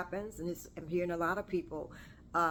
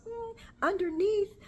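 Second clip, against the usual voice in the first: much higher, louder voice for the lady; imitating the lady; 4 dB louder than usual. Gray-haired lady answering about gray hair, imitated much higher. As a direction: pitch up to mimic her, a bit louder. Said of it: "And she goes".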